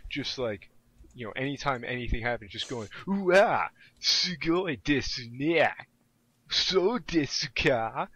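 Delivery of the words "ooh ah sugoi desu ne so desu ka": loud, slow, higher fake Japanese accent; hammed-up gushing in overdone Japanese accent; weird mock-Japanese voice. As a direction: imitate a classmate's exaggerated Japanese-accented voice. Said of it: "in this really weird, fucked up voice".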